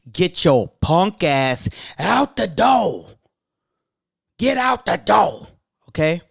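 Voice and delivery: drawn-out, put-on kicking-out voice; mock ejection. Host telling negative listeners to leave, hammed-up dismissal. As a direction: throw them out in a hammed-up, rising cry.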